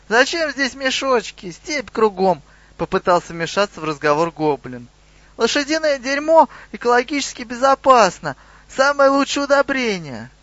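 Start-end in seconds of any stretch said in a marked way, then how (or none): 0.1-2.4 high-pitched goblin protest; squeaky goblin interjection; indignant butting-in. A narrator's reading. Goblin butts into the argument. 5.4-10.3 very high-pitched, insistent argument; high goblin voice, making a case; protesting goblin. A narrator's reading. Goblin keeps arguing with the officer.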